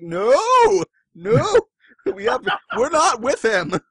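high, loud, frantic cry of no, no, we're not with him; panicked denial. Retold or imitated. Deku and Ida disowning Bakugo to the crowd, frantic high shouted denial.

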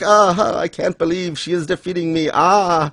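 hammed-up cry of disbelief; mock dismay. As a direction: wail it like a dramatic loser. Acting out a dismayed rival.

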